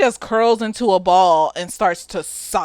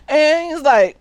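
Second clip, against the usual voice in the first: ordinary talk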